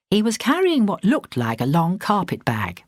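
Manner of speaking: usual speaking voice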